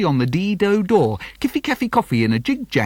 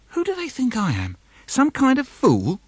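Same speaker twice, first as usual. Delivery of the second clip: high, exasperated protest; who do they think I am, rising higher; indignant refrain; 9 semitones higher than usual. Acted.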